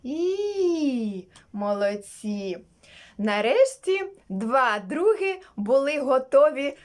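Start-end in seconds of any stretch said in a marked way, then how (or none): 0.0-1.3 high, long "І" through a smile; smiling "ee". Shown as a demonstration. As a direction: sing out a smiley "ee". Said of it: "Повторіть за мною звук". (високе усміхнене «І»)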